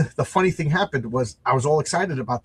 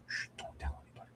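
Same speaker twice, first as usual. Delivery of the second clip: hushed, voiceless whisper; mock-secret whisper; 19 dB quieter, faster than usual. Confiding to viewers about writing on paid time, mock-secret whisper. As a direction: shush them and whisper it like a secret.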